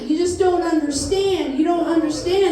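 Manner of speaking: ordinary talk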